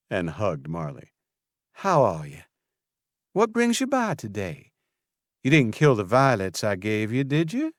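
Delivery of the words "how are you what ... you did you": chatty Southern welcome; Southern florist greeting an old friend warmly; southern accent. A narrator's reading. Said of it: "an accent so southern".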